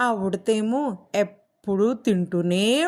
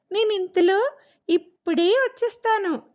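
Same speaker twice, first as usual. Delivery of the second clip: high, eager parrot voice; 10 semitones higher than usual. Acted. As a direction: chirp it back like a parrot hurrying over. Parrot voiced replying to squirrel. (చిలుక ఉడతకు చెబుతూ, ఎత్తైన ఉత్సాహపు గొంతు)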